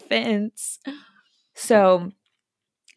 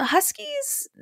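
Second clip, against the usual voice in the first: puzzled echo; 6 dB quieter than usual. Retold or imitated. Neighbour puzzled by the question.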